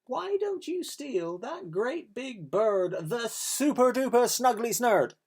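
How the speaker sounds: goose tempting the thieves, high and sly; cunning goose. Acted. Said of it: "She hissed at them".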